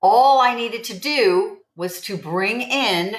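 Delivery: normal talking voice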